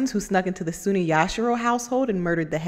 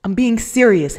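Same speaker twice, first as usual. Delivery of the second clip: firm, louder rebuke; stern; 4 semitones higher, 8 dB louder than usual. Acted. Lieutenant scolding the teasing Head Captain. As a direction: cut through his joking.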